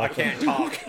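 high, loud mock-panicked cry; mock frantic shout. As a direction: shout it like you're too busy under fire. Mocks her phone call mid-firefight.

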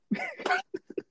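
soft, very high-pitched laugh; real high giggle. Real, not acted. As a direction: giggle helplessly at your own joke. Laughs at his own texting joke.

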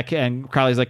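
ordinary delivery

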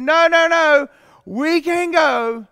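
loud, frantic no no no; mock panicked refusal. Fearful spies shouting to Moses, loud and panicked.

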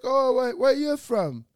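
high, loud suspicious African cabbie voice; loud, high mock of a distrustful driver. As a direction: mimic a wary cab driver squinting at you. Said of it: "They didn't trust me".

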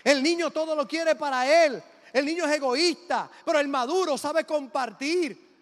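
loud, ringing preacher's voice; full-force preaching. Acted. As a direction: preach it out to the congregation.

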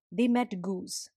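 ordinary delivery

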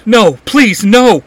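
high, loud crying plea; a loud, high sobbing plea; tearful plea. A narrator's reading. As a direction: beg through tears. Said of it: "Clary cries out".

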